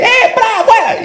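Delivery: very high mock exorcist yell; imitated old shouting. Pastor showing how demons were once expelled, high mock shout. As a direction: mimic yelling a demon out. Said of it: "no te gritaba antes".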